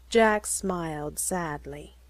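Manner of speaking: usual speaking voice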